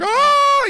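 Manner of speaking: startled scream. Retold or imitated. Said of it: "and he's like".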